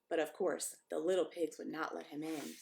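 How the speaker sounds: usual speaking voice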